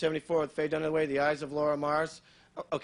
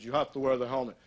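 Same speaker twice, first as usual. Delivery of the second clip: a lower-pitched man's order; lightly voiced instructor; 5 semitones lower than usual. Man at the skydiving place to customer, lightly voiced order. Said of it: "He said".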